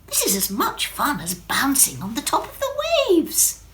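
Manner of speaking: a little drop having fun; delighted Wendy. Acted. Drop enjoying the sky, happily high-voiced.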